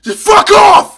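very high, very loud outburst; furious yell. Real, not acted. Gamer to player popping up, furious yell. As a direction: yell it with full force.

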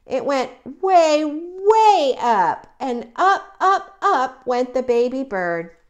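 high, loud stretched "way, way up"; excited storyteller climbing with "up, up"; rising "up, up, up". A narrator's reading. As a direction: lift "way" and every "up" high and loud. Narrating the rise upward.

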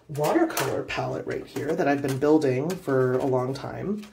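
usual speaking voice

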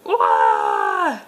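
low playful shout; big pretend wow for a kid; mock amazement. Last dinosaur revealed.

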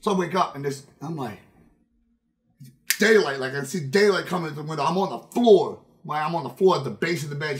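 usual speaking voice

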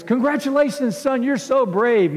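high, loud mock praise from a pompous captain; hammed-up captain. Three Stooges captain praising volunteer.

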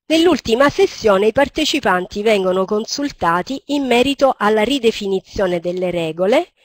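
ordinary delivery

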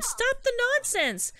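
very high, drawn-out protest; acted exasperation. Yuna rejecting his illusion story, high exasperated protest. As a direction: squeal it slowly in disbelief.

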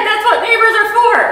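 high, loud, amazed quote; amazed repeat. Retold or imitated. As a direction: repeat it in disbelief. Repeating his kind words amazed.

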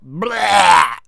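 loud, high retching "blah"; disgusted "blah". Real, not acted. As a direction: blurt out a big yell of disgust. Disgust at self-planned birthdays.

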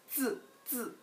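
쯔 falling steeply from high; clean fourth tone. Shown as a demonstration. Teacher shows a very clean sound, clean fourth tone. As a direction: say 쯔 short and neat, pitch dropping. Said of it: "발음을 굉장히 깔끔하게".

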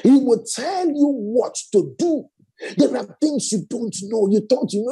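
normal talking voice

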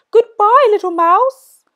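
high, loud hooting alarm; panicked owl. Acted. Owl flees from the mouse, hooting in alarm. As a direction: hoot in fright and flee.

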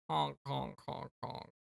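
soft honks fading to a whisper; fading clown honks. Pretend. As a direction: let the clown honks die away. Clown calming down.

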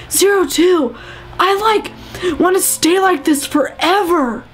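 high-pitched, overwrought love confession; hammed-up emotional confession; lovestruck bro confessing.

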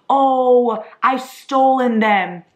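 high, loud, drawn-out lament; mock horror. Realizing the photo props were stolen, mock horror. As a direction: moan it slowly as a pretend criminal.

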